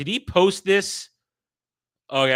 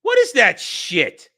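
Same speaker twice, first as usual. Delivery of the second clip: outraged shout; 10 semitones higher, 10 dB louder than usual. Real, not acted. Streamer reacting to a pasta-pairing rule, outraged shouting. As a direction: yell back at the screen, stretching the words.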